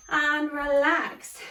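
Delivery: slow, drawn-out soothing cue; calm release. Real, not acted. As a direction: let them breathe out, slowly. End of interval cue.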